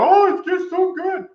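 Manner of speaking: high, slow, syrupy mock gushing; gushing fan. Viewers praising his background, in a high mock gush. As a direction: coo it like an over-the-moon fan. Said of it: "Everyone's like".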